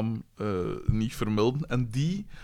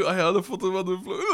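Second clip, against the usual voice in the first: higher, fast, laughing gush; mock gushing woman; 6 semitones higher, faster than usual. Imitating the woman gushing over Floris's photo, laughing. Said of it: "op peer dingen zo".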